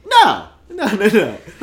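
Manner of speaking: high, loud no through laughter; laughing protest. Real, not acted. To co-host joking about dwarf names, laughing no. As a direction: laugh and wave the joke away.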